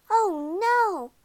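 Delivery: high, loud, drawn-out dismay; dismayed groan. A narrator's reading. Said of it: "Poppy groaned".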